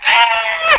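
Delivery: sudden high shout; a bee stinger in your palm; retold yelp of pain. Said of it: "I'm like".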